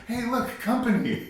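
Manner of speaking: high-pitched cheery voice with laughter; hammed-up naive welcome, laughing; mock delighted greeting. Mocking characters' glee at creature.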